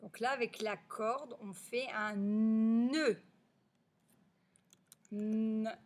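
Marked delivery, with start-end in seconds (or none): 2.2-5.8 NŒUD with long loud n, then N alone; elongated initial n. Shown as a demonstration. Teacher shows the first sound, "nœud" drawn out. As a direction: hum the n of "nœud". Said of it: "c'est le son N".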